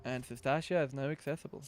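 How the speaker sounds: ordinary delivery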